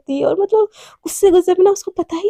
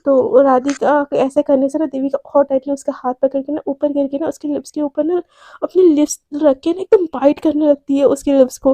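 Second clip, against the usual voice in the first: plain reading voice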